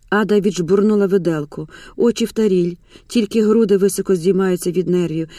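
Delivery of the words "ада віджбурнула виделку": usual speaking voice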